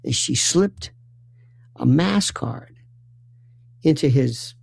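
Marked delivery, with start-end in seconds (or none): none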